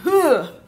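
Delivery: sudden loud, high "ugh"; big burst of an ugh; loud grunt. Real, not acted. Groans while doing makeup.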